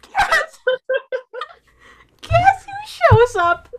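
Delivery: very high, loud, slow squeal; overcome squeal. Real, not acted. Fan revealing who appears behind her, squealing. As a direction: squeal it out slowly, barely holding together. Overwhelmed telling who shows up.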